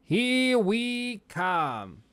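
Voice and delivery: slow, high mimicry with a slight put-on accent; Mario movie voice. Viewer replaying that odd accent moment, drawn-out mimicry. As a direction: draw every word out, mimicking the hero. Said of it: "he puts on a slight accent".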